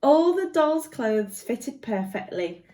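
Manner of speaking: usual speaking voice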